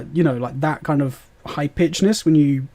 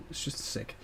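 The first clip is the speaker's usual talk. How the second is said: whispered; 17 dB quieter than usual. Real, not acted. Whispered aside about weapon.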